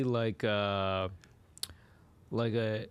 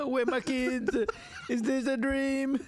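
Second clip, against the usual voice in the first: high, slow Jamaican bewilderment; laughing Jamaican confusion; Jamaican accent; 12 semitones higher, slower than usual. Acted. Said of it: "in Jamaican Inception".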